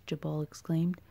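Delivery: ordinary delivery, nothing marked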